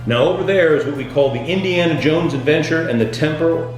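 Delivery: normal talking voice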